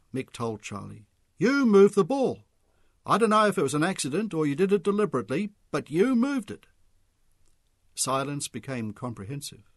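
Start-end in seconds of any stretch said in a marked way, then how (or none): 1.4-6.7 loud, higher accusation. A narrator's reading. Mick accusing Charlie of moving the ball, loud. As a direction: press the accusation hard.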